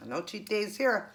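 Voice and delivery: ordinary delivery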